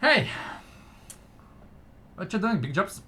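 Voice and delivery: high, teasing little gnome; cheeky small gnome calling. Acted. Gnome addressing player character.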